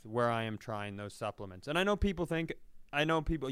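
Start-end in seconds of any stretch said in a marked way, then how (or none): none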